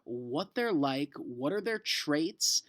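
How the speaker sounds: ordinary talk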